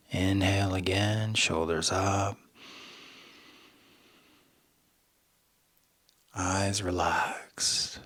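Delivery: soft, slow breathing-practice voice; guided meditation. Shown as a demonstration. Coach guiding listeners through inhale and exhale, hushed meditation voice. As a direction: hush it and leave room between each cue.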